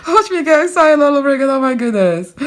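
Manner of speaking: high, loud and fast with giddy glee; bursting with delight at the bead; squealing, rushed and thrilled; excited all over again. Real, not acted.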